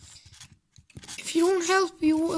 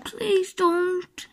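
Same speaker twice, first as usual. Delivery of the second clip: usual speaking voice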